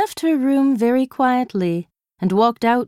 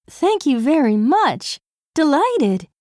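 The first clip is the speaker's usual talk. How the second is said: high, gracious princess voice; cartoon princess; 6 semitones higher than usual. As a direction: play a lofty royal, sweetly. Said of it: "said Anne in her princess voice".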